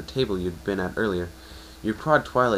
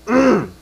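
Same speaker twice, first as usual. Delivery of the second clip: hum; 13 semitones higher, 12 dB louder than usual. Acted. Sound before sad voice.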